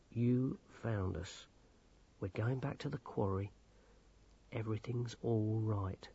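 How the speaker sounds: very soft, low reassurance; soothing. A narrator's reading. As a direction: speak gently to calm someone distraught. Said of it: "he said soothingly".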